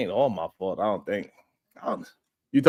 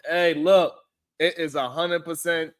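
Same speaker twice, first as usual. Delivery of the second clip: normal talking voice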